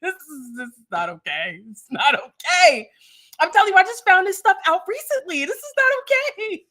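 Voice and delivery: high, loud mock wail; mock distress. Real, not acted. Godmother wailing to niece that it's not okay. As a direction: ham up the dismay with a cry. Godmother dramatically reacting to news.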